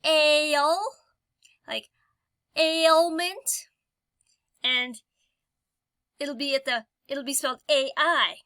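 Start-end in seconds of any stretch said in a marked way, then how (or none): 0.0-3.7 ale and ailment with a loud, drawn-out opening A; teacher stressing the starting A; sounded-out word. Shown as a demonstration. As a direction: lean hard on the first A sound. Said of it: "A sound in the very beginning".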